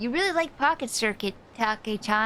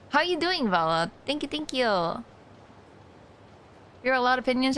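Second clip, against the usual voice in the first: ordinary talk, nothing marked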